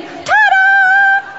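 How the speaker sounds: high, loud, long flourish; triumphant ta-da demo. Shown as a demonstration. Speaker showing one side what to say, triumphant shout. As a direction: belt it out with showmanship. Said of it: "You're going to say".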